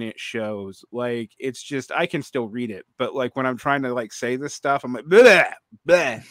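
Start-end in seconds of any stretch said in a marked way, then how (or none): 5.0-6.2 loud sloppy blurted gibberish; garbled mock German. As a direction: mush the sounds like a drunk Englishman. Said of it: "Just say it like a drunk Englishman".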